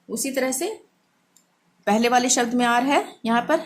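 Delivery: normal talking voice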